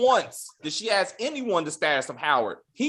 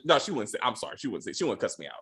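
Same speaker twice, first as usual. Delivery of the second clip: normal talking voice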